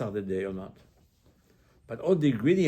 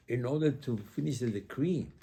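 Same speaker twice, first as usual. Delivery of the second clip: ordinary talk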